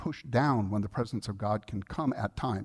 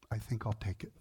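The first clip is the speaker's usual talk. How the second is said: much softer, almost under the breath; quiet humble acceptance; 4 semitones lower, 9 dB quieter than usual. Retold or imitated.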